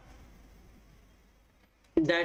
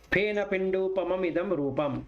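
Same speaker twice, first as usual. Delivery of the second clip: sutta recitation; 2 semitones lower than usual. Acted. Teacher reciting the Phena Pindupama Sutta, measured recitation. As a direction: recite each simile line evenly.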